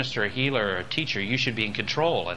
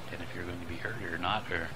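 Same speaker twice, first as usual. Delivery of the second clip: not heard; 5 semitones lower, 11 dB quieter than usual. Shown as a demonstration. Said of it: "Another one now is to be heard".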